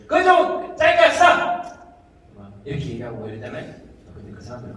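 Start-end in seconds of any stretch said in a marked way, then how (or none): 0.1-1.8 loud, slow, blunt demand to cut it short; impatient shout. Retold or imitated. Gyeongsang or Seoul listener cutting off a long talk, loud and slow. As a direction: bark it out to hurry the speaker. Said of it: "경상도나 서울 사람들은".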